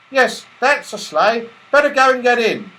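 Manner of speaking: porter's voice. Acted. Porter urging the toys into the sleigh, high and brisk. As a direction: hurry the visitors along.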